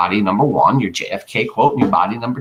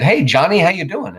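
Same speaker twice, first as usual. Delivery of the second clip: friendly greeting; 7 semitones higher, 4 dB louder than usual. Retold or imitated. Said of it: "and somebody said".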